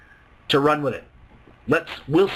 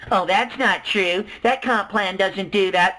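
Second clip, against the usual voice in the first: slow, sneering skeptic voice; a mocking skeptic who won't believe it; mock doubter; slower than usual. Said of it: "people aren't gonna believe it".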